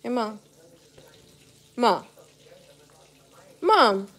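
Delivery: rising call for Mom. Real, not acted. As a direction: shout for Mom to hear you. Calling out to mom.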